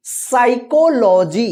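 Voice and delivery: साइकोलॉजी drawn out slowly, starting straight on "sai"; silent P. Shown as a demonstration. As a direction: say साइकोलॉजी syllable by syllable, no P sound. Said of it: "इसको पढ़ेंगे".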